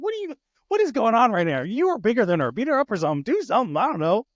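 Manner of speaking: high, loud mock disbelief; exasperated parent. Dad to the bigger daughter who got hit, acting exasperated.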